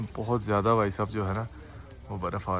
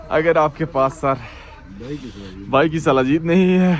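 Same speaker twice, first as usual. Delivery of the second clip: loud; 8 dB louder than usual. Acted. Punchline of product plug.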